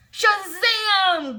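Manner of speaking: high loud superhero cry; little girl yelling a comic-book cry; yelled Shazam. A narrator's reading. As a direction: shout it like a comic hero. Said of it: "Gladys yelled".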